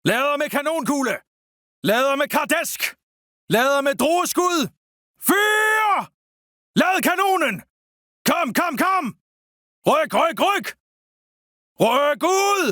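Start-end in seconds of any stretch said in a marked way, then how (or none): 5.3-9.0 high, forceful order; shouted gun command. Acted. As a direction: drive the cannon crew on.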